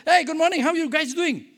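bright, loud hello to the nurses; cheery greeting. Retold or imitated. Retelling his cheerful hello to the nurses at the seminar, loud and bright. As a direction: greet them like it's a great day.

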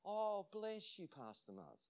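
slow, high 'oh', trailing down softly to patronise; condescending. Retold or imitated. Sick woman humouring the visiting pastor, condescending. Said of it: "she was being condescending".

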